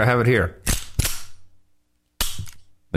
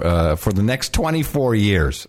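normal talking voice

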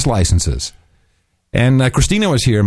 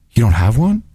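fake shocked gasp. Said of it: "I had him going".

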